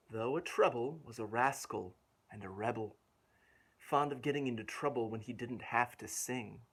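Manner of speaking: put-on lilting read-aloud rhythm for a child; rhymed story reading. A narrator's reading. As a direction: bounce through the rhyming verse. Rhyming verse continues.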